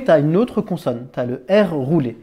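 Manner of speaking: ordinary talk, nothing marked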